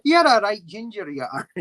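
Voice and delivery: light Scottish teasing; a hint of a Scottish accent; mock Scottish accent. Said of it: "mock Scottish phrase".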